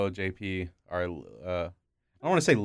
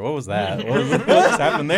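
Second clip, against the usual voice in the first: laughing; 6 semitones higher, 10 dB louder than usual. Real, not acted. Laughing at awkward silence.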